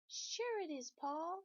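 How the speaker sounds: high, sing-song reassurance; pigtail voice. Acted. Pigtail reassuring Paul, high and sing-song. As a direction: coax him with a high lilt.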